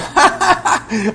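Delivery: high mocking cackle; imitated burst of laughter. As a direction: mimic the shopkeeper bursting out laughing. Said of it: "y soltó la risa". (carcajada aguda imitada)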